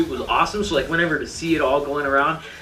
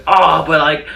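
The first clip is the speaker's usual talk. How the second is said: louder, fast, gushing praise; louder, quick, awestruck admiration; excited gushing; 4 semitones higher, 9 dB louder than usual. Retold or imitated. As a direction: blurt it out like a thrilled fan. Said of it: "I'm like".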